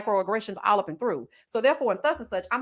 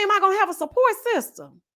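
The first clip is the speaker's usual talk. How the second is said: mocking quote; 8 semitones higher, 4 dB louder, faster than usual. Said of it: "She gonna ask me".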